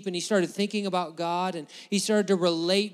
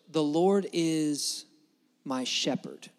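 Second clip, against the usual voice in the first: slow solemn reading; 2 semitones lower, much slower than usual. Acted. Preacher reading the psalm's opening line aloud, slow and solemn. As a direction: draw out the verse slowly, reverent. Reading the key verse slowly.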